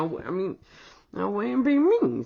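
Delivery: ordinary talk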